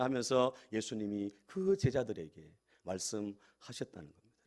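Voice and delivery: normal talking voice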